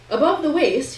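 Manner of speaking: ordinary delivery, nothing marked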